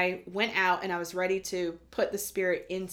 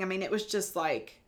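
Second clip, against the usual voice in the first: normal talking voice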